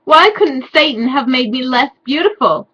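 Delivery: a hammed-up cry at Satan; mock angry shout. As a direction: overplay the tantrum. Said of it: "I shouted angrily".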